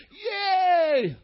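loud, high drawn-out hooray; mock cheer. Preacher mimicking the athletes' brief glory, loud mock cheer. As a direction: cheer like a crowd, hammed-up.

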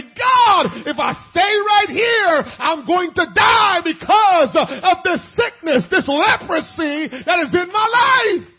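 loud, high, slow anguished plea; desperate cry to God. Retold or imitated. Leper crying out to God, loud desperate plea. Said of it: "acknowledge it and say".

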